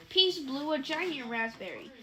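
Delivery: usual speaking voice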